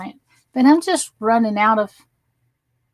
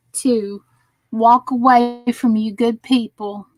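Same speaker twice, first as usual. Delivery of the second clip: normal talking voice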